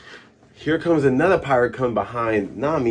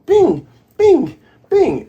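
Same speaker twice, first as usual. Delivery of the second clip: high loud bing noises; slingshot sound; 7 dB louder, slower than usual. Retold or imitated. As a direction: fire off shots with your voice. Imitating Usopp's slingshot shots.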